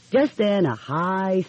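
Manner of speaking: plain reading voice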